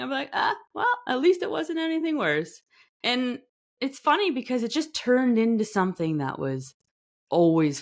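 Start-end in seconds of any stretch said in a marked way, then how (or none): 0.3-2.6 brushing it off. Retold or imitated. Quote after "I'm like.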